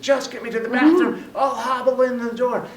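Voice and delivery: high, insistent mock yell; high-pitched pleading outburst, acted; retold desperate yell. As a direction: demand it urgently, imitating the woman. Wheelchair woman screaming, acted.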